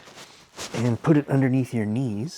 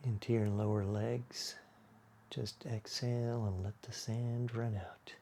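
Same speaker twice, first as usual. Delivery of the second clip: unhurried, hushed yoga-class body scan; guided meditation; 7 dB quieter than usual. Acted. Leads a guided relaxation.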